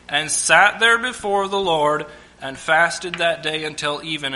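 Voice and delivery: measured Bible reading voice; preacher reading scripture to the church. Acted. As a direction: read the passage with weight.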